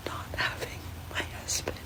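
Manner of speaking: soft tearful whisper. Real, not acted. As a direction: whisper as if holding back tears. Tearful whisper about husband.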